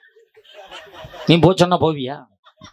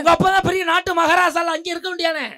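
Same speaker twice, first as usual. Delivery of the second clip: high, raised-voice heckle; heated retort; 8 semitones higher than usual. Real, not acted. Husband challenging the wives' debater, heated retort. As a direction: argue back at a much higher pitch.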